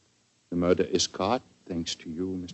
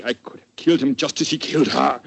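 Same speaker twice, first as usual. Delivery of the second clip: louder, sudden flare of fury; rising anger; 5 semitones higher, 8 dB louder than usual. Acted. Grieving count tells the doctor of his anger, flaring up loud. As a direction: let the rage break through. Count recalls rage at killer.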